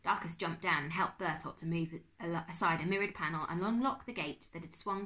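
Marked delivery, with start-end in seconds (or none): none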